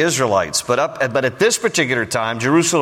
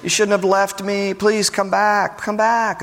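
higher-pitched, coaxing mock plea; pleading kindly; 4 semitones higher than usual. Husband begs his runaway concubine to return, kindly pleading. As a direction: coax her gently, imitating a kindly husband. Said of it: "So he speaks kindly to her".

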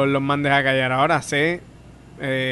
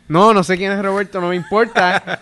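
loud, higher, fed-up comeback; exasperated loud retort; 5 semitones higher, 7 dB louder than usual. Retold or imitated. Comic loudly shuts down a heckler, fed up. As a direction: snap back loud, you don't care. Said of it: "Y yo".

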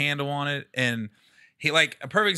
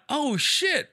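realizing; 11 semitones higher than usual. Retold or imitated. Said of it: "I went".

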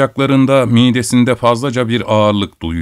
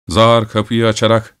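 ordinary delivery, nothing marked

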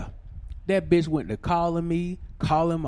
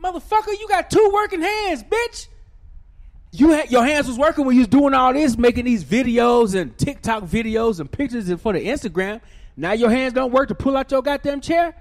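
high, loud angry tirade; retold rant at the date about the chair; furious yell; 8 semitones higher, 7 dB louder than usual. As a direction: chew her out at full volume.

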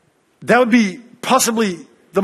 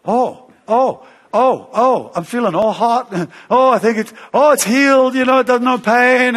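excited relief; 6 semitones higher than usual. Retold or imitated. Healed girl tells the crowd the pain has gone, excited. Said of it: "she says".

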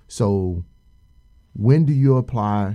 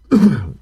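loud throat clear; 6 semitones higher, 10 dB louder than usual. Real, not acted. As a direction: clear your throat sharply. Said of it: "my apologies for the coughing".

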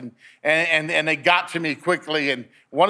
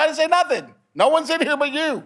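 high-pitched protest; retold bewildered denial; 11 semitones higher than usual. Tech telling patient nobody else is there, protesting. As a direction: act out protesting innocence, voice way up. Tech denies having spoken.

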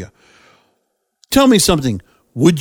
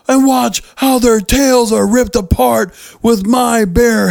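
high, slow, hammed-up sing-song menace; Triple H voice; 5 semitones higher, slower than usual. Host mocking the nursery rhyme promo in a mock Triple H voice. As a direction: stretch every word like a campy villain. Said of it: "hear Triple H's voice if he said this".

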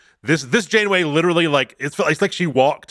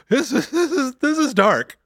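delighted shock; 13 semitones higher than usual. Real, not acted. Reacting to dark scene.

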